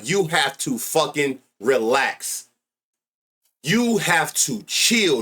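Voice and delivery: drawn-out deliberate plea; slow emphatic. Real, not acted. Streamer telling King Quan to relax, slow and deliberate. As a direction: spell it out slowly for him.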